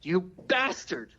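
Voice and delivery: high, drawn-out curse without shouting; mock angry woman. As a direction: imitate a furious woman's parting insult. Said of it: "the woman gets so angry".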